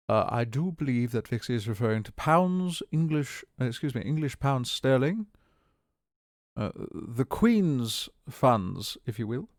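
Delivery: put-on lightly posh English accent; hint of a stuffy British accent. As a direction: play a prim English gent explaining pounds. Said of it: "I do a lot of voices and accents".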